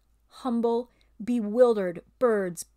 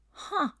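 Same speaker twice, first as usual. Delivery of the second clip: high short grunt; a puzzled grunt; 9 semitones higher than usual. Acted. Bear grunts at the ape's claim. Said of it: "grunted the bear".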